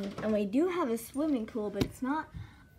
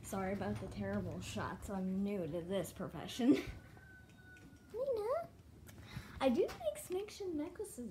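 ordinary talk

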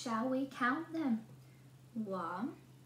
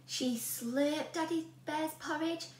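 plain reading voice

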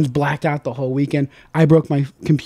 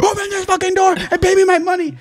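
angry cab driver; 13 semitones higher than usual. Retold or imitated. Cab driver demanding payment.

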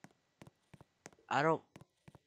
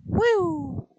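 whooping cheer; 9 dB louder than usual. Real, not acted. Flung through the air.

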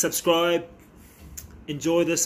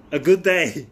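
excited; 4 semitones higher, 9 dB louder than usual. Real, not acted. Happy with his pulls.

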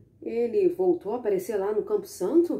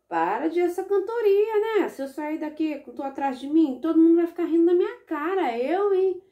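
high, louder annoyed complaint; exasperated pleading; 7 semitones higher, 4 dB louder than usual. Acted. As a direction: beg it to stop, fed up. Boy scolds the poop. (menino irritado, voz aguda)

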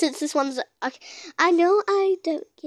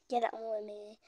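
very soft, low whisper; whispering to self; 6 semitones lower, 16 dB quieter, faster than usual. Real, not acted. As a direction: murmur it privately under your breath. Reads typed words back to self.

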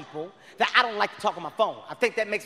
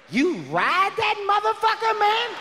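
squeaky, much higher, slow mock question; incredulous retort; 11 semitones higher, slower than usual. Snapping back at the sixty-five-year-old "baby", high and slow. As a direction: jump way up in pitch and drag it out. Mocking advice to the old man.

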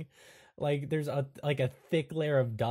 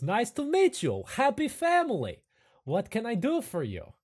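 high, eager hunchback greeting; Igor voice; 9 semitones higher, 3 dB louder than usual. Acted. Igor greets the new family, high and eager. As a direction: greet them brightly as the hunched librarian. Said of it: "Igor says".

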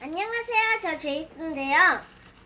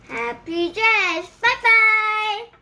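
bright higher, louder, slow farewell; loud cheerful goodbye; 6 semitones higher, 7 dB louder than usual. Acted. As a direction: sing out the goodbye.

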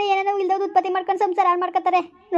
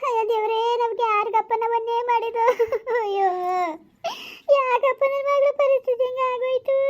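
crying lament; 4 semitones higher than usual. Acted. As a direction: wail it out like a grieving mother. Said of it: "ಅಮ್ಮ, ಯಾಕೆ ಅಳ್ತಾ ಇದೀಯಾ?".

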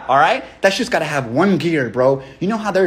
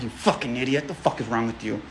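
under his breath, much softer and lower; muttered aside; 6 semitones lower, 10 dB quieter than usual. Real, not acted. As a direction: grumble it to yourself, barely heard. Muttering insult to himself aside.

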